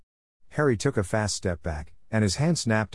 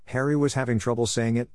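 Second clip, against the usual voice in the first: ordinary delivery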